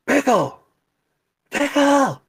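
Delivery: high, loud yell of a name. Retold or imitated. Worker yelling for the absent manager, loud and high. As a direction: shout for the manager again and again. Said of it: "So I yell".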